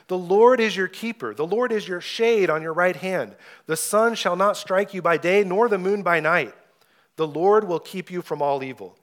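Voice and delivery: scripture reading. Acted. Pastor reading the psalm to his congregation, ringing and bold. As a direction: read it out boldly like a preacher at the pulpit. Preacher reads Psalm 121 aloud.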